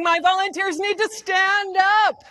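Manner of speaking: projected emcee call. Real, not acted. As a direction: summon volunteers across the room. Calls volunteers up from audience.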